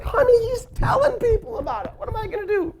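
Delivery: high, panicky whine to his wife. Retold or imitated. Husband pleading with wife, high panicky voice. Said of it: "I'm like".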